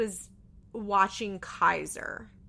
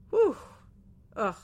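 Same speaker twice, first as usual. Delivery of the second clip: normal talking voice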